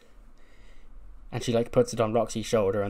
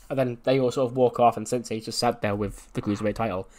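normal talking voice

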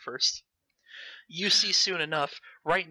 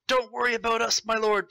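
urgent guards; 6 semitones higher, 4 dB louder than usual. Acted. Castle guards urging royals flee.

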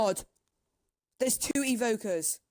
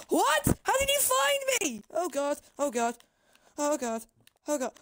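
high, frantic panic; spooked gamer yelping in panic; panicked; 6 semitones higher than usual. Real, not acted. Mob finds him, panicking.

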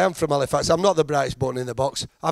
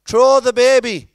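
put-on high, loud yell in a botched Belgian accent; drawn-out shout, hopeless stab at a Belgian accent; bad Belgian accent; 10 semitones higher, 9 dB louder, slower than usual. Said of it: "I can't do a Belgian accent".

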